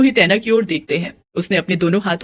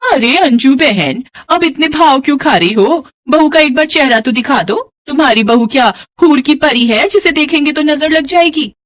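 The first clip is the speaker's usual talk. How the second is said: teasing neighbour; 5 semitones higher than usual. Acted. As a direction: poke fun at her pride. (चिढ़ाती हुई ऊँची आवाज़)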